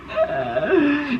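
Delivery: a sudden high, embarrassed laugh. Real, not acted. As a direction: laugh it off, a little guilty. Embarrassed about her own remark.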